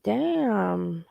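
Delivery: ordinary delivery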